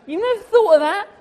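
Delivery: high, loud incredulous question; mock disbelief. Challenging executives about the cleaner, incredulous. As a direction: act amazed they never thought of it.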